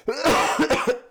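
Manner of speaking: cough. Real, not acted. Sick host coughs on mic.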